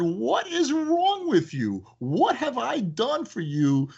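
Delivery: slow, high teasing reproach; mock scolding. Owner scolding a customer who harassed support, slow playful reproach. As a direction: drag it out like a playful telling-off. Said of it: "I said".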